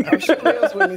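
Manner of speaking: big laugh, then words, high and loud. Real, not acted. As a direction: burst out laughing, keep laughing. Laughing at the roast.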